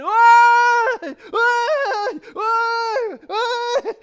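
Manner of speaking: very high, loud crying wail of why; imitating a professional mourner's loud sobbing cry. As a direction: wail like a hired mourner at a graveside. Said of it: "there's this woman going".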